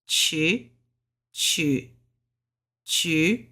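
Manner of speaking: chu drawn out with a clear CH sound; Mandarin chu. Shown as a demonstration. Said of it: "This is kinda like a C H".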